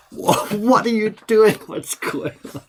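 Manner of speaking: high slow gasp of disbelief fading to a whisper; mock amazement trailing into a whisper; stunned woman. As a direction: imitate her shock, then let it drop away breathless. Woman stunned by correct guess.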